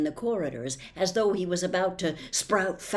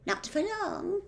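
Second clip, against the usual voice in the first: high, a touch smug; satisfied; 14 semitones higher than usual. Acted. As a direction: sound pleased with herself. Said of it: "in a satisfied tone".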